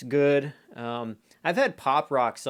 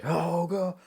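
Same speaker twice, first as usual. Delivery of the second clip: mock excited kid; 9 semitones higher, much slower than usual. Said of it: "you may be like".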